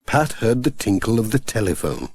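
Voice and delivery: ordinary delivery, nothing marked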